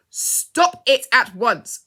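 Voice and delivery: loud, slow commanding shout. A narrator's reading. Mister Discover the Truth stops two fighting men, loud shout. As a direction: stop the fight with one loud order. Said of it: "shouted Mister Discover the Truth".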